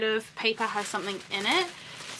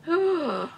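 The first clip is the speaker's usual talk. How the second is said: sharp gasp; 7 semitones higher than usual. Real, not acted. Opening a beautiful letter.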